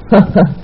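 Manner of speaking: brief mock laugh at a silly worry; dismissive chuckle. Officer laughs at her fear. (طنزیہ مختصر ہنسی)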